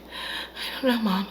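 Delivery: small, high, hushed voice; imitated timid reply. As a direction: answer slowly, barely above a breath. Beth hesitantly answering her mother.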